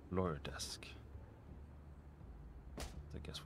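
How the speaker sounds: hushed whisper to herself. Real, not acted. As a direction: breathe it out as a whisper. Quiet muttering to self.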